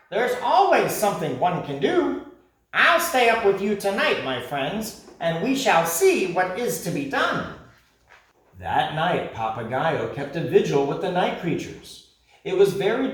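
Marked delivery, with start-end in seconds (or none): 0.1-7.5 high, bold parrot voice; rally them with confidence; brave parrot. Acted. Parrot promising to stay up with friends, high and bold.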